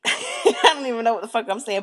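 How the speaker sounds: real laugh breaking into the words; amused, losing it mid-sentence; laughing at self. Real, not acted. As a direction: talk through your own laughter. Laughs at her own muddled description.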